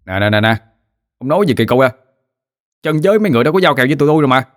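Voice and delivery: rising fast, heated complaint; angry protest. A narrator's reading. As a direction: snap back at him, speeding up. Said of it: "nói mà như quát".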